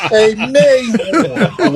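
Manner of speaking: exaggerated French Lick drawl, very slow and high; Larry Bird. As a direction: mimic the French Lick tone, dragging out "Mayhorn". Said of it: "his French lick tone".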